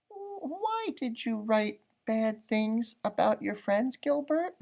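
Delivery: put-on high, slow, gentle girl's voice asking; a little girl gently asking a classmate why; Patty's curious question. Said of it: "Patty finally asked".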